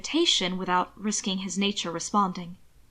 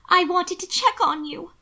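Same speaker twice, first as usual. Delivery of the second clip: high, anxious sister's voice; teary; 8 semitones higher than usual. A narrator's reading. Sister checks on her hurt brother, high and teary. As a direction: voice lifted as if near tears. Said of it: "teary-eyed and pale".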